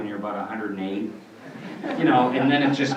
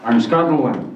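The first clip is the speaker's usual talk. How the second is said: put-on louder, mushy old Yiddish crustacean; Zoidberg voice; 6 dB louder, slower than usual. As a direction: whine and plead like Zoidberg. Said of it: "beautiful character".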